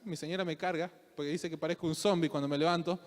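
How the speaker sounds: normal talking voice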